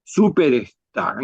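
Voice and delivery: slowly reading out SUPERSTAR; como leyendo. Acted. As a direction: scan a list, stretching out Superstar. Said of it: "como leyendo". (leyendo lento, alargando Superstar)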